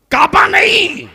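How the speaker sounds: short, high, loud cry; full-force preaching. Real, not acted. Preacher begins a list for the congregation, full-force preaching. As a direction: fire it out far above speaking pitch. Preacher cries the first item out.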